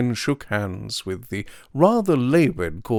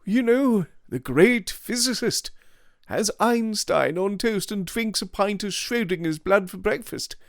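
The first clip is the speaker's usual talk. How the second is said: languid upper-class gent; 10 semitones higher than usual. Acted. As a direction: play him smug and breezy. Devine's voice held.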